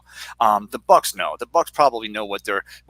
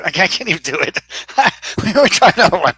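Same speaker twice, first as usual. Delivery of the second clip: ordinary talk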